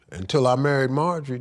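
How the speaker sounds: ordinary delivery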